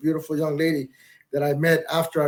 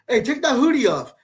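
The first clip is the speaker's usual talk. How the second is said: higher-pitched parental command; retold fatherly telling-off; dad ordering son; 8 semitones higher than usual. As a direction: order your kid about like a fed-up dad. Dad orders son over screen.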